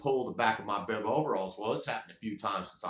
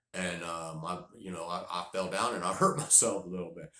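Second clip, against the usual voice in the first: usual speaking voice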